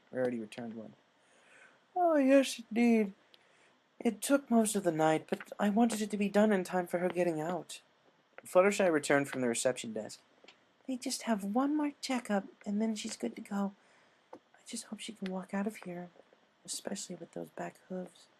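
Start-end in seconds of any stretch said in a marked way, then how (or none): 2.0-7.8 high, refined lady voice; Rarity voice. Acted. 10.9-18.3 put-on high, soft, timid girl. Fluttershy voiced, worried.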